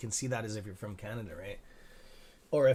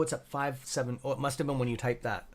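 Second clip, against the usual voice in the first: ordinary delivery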